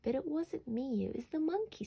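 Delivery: put-on high, innocent child voice protesting; protesting little boy. A narrator's reading. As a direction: squeak out the excuse like a small boy caught out. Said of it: "said Alfie".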